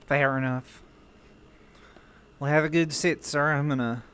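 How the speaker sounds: relaxed Southern character voice; southern accent. Acted. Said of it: "instead of my southern voice".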